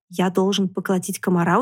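usual speaking voice

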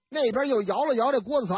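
ordinary delivery, nothing marked

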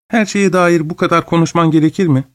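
put-on a boy cutting off his rambling grandfather; impatient interruption. A narrator's reading. Edwin cutting off his grandfather, mildly impatient. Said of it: "sabırsızlanarak sözünü kesti".

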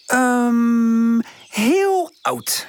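long high hesitant hum; stall, then land on a high-pitched answer; thinking hard. A narrator's reading. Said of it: "zegt ze uiteindelijk". (hoog, langgerekt aarzelend)

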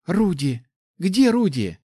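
high, eager question; happy asking. A narrator's reading. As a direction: call for a loved one, happily expectant. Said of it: "со счастливым выражением спрашивает".

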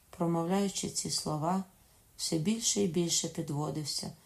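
plain reading voice

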